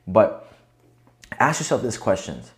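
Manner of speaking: ordinary delivery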